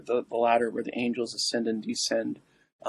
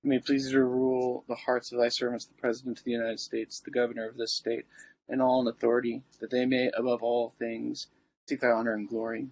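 prayer; 4 dB quieter than usual. Acted. Recites the litany.

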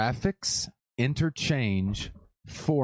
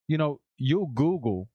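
normal talking voice

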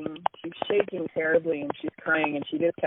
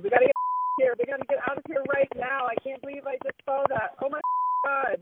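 high, fast, frightened cry to flee; frightened friend urging escape from the cemetery, high and rushed; retold panicked scream; 8 semitones higher, faster than usual. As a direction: panic in the voice, pitched way up. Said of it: "Audrey screamed".

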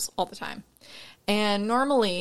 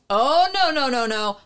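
raised, louder opening sliding down; mock protest; 5 semitones higher, 6 dB louder than usual. Customer refusing the extra charge, mock protesting. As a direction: imitate a customer's insistent objection.

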